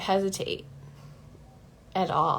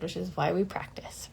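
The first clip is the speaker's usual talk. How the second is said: whisper; 4 dB quieter than usual. Real, not acted. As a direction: whisper it as an aside. Aside about practice, whispered.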